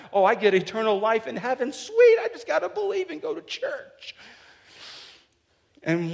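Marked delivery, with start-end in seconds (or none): 0.1-4.1 high, far-off mocking voice of a shallow believer; hammed-up naive believer; mock churchgoer. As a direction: lampoon the cheap-faith attitude, pitch way up.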